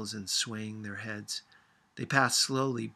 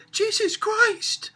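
high, loud, drawn-out shriek; 10 dB louder, slower than usual. A narrator's reading. Sophia shrieking at the roaring bulldozer, high and loud. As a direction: shriek it in sheer shock. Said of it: "Sophia shrieked".

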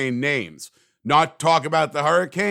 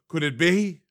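gasp, then a tentative question; first dawning of mock hope; mock wonder. Acts out hopeful suspicion of return.

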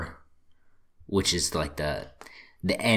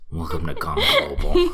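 laughing; 5 dB louder, faster than usual. Real, not acted. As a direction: giggle through the welcome. Laughing while restarting intro.

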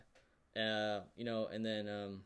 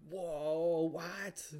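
high, drawn-out amazement, loud at first; hammed-up reaction to a plot twist; mock shock; 8 semitones higher, 5 dB louder than usual. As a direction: swoop up high in disbelief.

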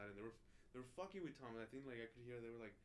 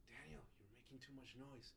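hushed, slow whisper; whispered complaint; 7 dB quieter than usual. Retold or imitated. Roommate whispering at him to keep quiet. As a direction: hiss it softly so nobody wakes.

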